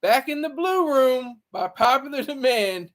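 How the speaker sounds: announcer intro. Acted. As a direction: hype up the guest like a ring announcer. Mock announcer introducing a guest.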